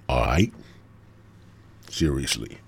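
ordinary delivery

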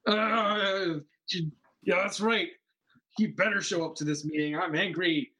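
belligerent rant. Acted. Angry impostor complaining about a missed meeting, belligerent play-acted ranting. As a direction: rant belligerently into the air, hammed up. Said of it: "I'm still being belligerent after that".

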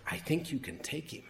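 quiet, sly inner voice aside; a softly voiced inner prompting; interior voice. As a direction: say it softly, like a private thought. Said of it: "interior voice say".

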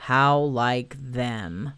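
low, very slow gloomy donkey voice; a sulky donkey muttering. Acted. Eeyore bitter remark.

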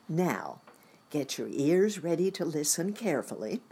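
usual speaking voice

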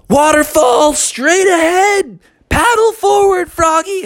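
high, drawn-out warning call. A narrator's reading. Bucky warns Froggy of the waterfall ahead, calling out. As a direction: call ahead to your paddling partner. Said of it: "heard Bucky say".

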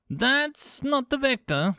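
high, slow, emphatic retort; a snappish objection in a high character voice. A narrator's reading. Five Clicks corrects a colleague while darting about, snappish. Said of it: "Five Clicks snapped".

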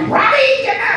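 very high, urgent mom call; a mother pulling her boy back; frantic mom shouting at her son; mother's voice. Retold or imitated. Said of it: "it was Robbie".

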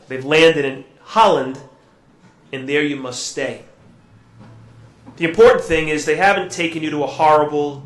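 ordinary delivery

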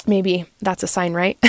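ordinary delivery

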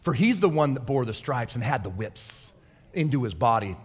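ordinary talk

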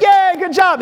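high, bright parental cheer; excited praise. Retold or imitated. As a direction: cheer like the baby just walked. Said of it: "you're like".

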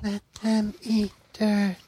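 put-on slow, high creepy child chant; little kid voice. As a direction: chant it slowly like a spooky child. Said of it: "Except it's in a little kid voice".

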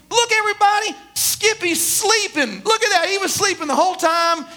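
lightly voiced young boy; child's voice. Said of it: "he was a child".

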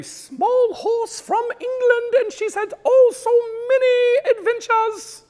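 very high prim little horse voice; squeaky tiny English horse; small horse voice. Retold or imitated.

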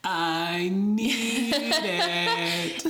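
drawn-out, high desperate cry; hammed-up begging with a laugh behind it; SpongeBob. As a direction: wail it slowly like a craving cartoon character. Repeats the SpongeBob wail.

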